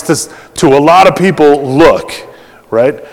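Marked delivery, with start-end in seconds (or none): none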